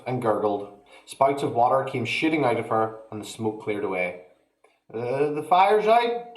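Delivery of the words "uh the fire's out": put-on slow, higher proud boy; proud announcement. A narrator's reading. George proudly declaring the fire out, slow. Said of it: "George announced proudly".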